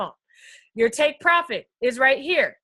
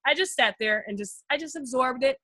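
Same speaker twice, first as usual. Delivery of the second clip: ordinary talk, nothing marked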